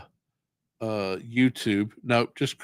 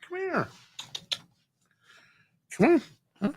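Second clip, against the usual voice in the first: coaxing pet talk; 4 dB quieter, faster than usual. Real, not acted. Coaxing the cat.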